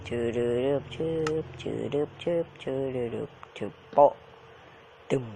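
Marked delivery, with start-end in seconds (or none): 0.1-4.1 mouth sound effects. Pretend. Gamer mouthing the digging sounds for viewers, beatboxed. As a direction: click and pop with the mouth like game sounds.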